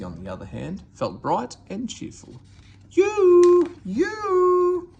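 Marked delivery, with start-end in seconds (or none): 2.9-4.9 very high, very loud horn-like toot. Acted. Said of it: "she tooted".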